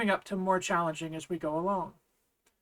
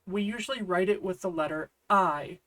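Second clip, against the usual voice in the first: usual speaking voice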